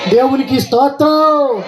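high, shouted blessing litany; preacher's cry, drawing out each ending; fervent prayer declaration. Acted. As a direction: proclaim each blessing on a high, driving note. Declares blessings over congregation.

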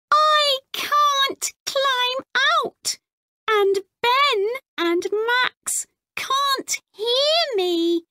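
a frightened little robot; scared robot voice. Acted.

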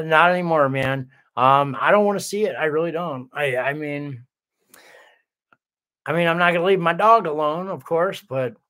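normal talking voice

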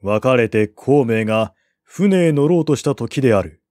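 plain reading voice

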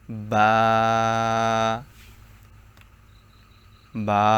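বা said from the moist part of both lips. Shown as a demonstration. Teacher demonstrating the letter, lip sound. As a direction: press the lips and say বা. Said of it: "বলুন আমার সাথে সাথে".

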